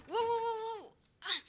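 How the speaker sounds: loud, high string of wows; startled cry. Pretend. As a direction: yelp in sudden alarm. Startled by sudden game event.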